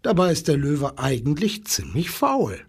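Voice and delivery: plain reading voice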